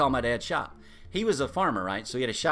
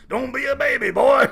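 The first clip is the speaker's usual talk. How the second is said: put-on higher, loud rebuke ending strong on "boy"; stern scolding; 9 semitones higher, 10 dB louder than usual. Daddy Price scolding grandson at the hose, loud rebuke. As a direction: scold him sharply.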